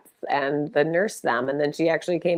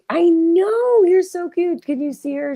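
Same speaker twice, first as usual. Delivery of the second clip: very high cooing to the kitten; high pet voice; 13 semitones higher, 6 dB louder than usual. Real, not acted. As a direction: gush over the cute cat.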